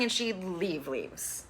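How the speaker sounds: ordinary talk, nothing marked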